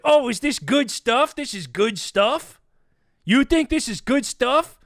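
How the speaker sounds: acted indignant challenge, slow and high; indignant confrontation. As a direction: throw the words back at them, drawn out. Confronting producer, echoing the overheard remark.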